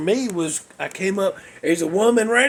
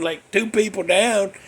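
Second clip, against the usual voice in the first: ordinary talk, nothing marked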